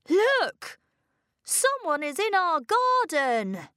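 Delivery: put-on high-pitched boy calling out; sudden call. A narrator's reading. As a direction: call the alarm to the family. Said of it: "Philip suddenly called".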